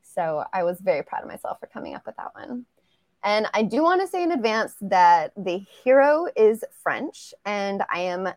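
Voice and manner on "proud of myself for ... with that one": whispered proud aside. Real, not acted. Proud aside about the title.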